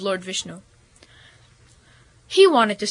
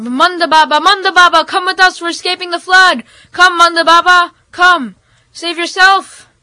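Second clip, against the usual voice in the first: high, raised calling out; urgent yell; 7 semitones higher, 6 dB louder than usual. Acted. People in the boat calling to him, urgent yell. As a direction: yell to coax him aboard. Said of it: "everybody in the boat yelled".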